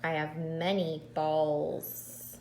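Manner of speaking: slow stars with the final S drawn out; stressing the plural. Shown as a demonstration. Teacher modelling plurals for kindergartners, slowly. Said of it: "So I add an S".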